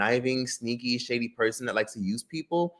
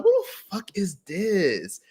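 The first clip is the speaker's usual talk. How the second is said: incredulous; 8 semitones higher, much slower than usual. Retold or imitated. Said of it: "I'm like".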